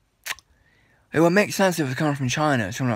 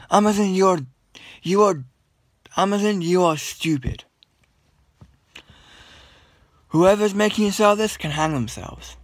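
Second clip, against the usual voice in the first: angry rant; 5 semitones higher than usual. Real, not acted. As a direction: vent at Amazon in a raised voice. Ranting at Amazon.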